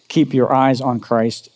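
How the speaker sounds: ordinary talk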